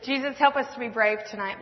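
ordinary talk

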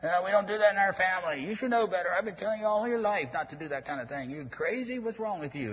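high, loud scolding father; furious father berating his child; angry parent yell. Retold or imitated. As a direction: scold a wayward kid in full fury. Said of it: "You yell at 'em".

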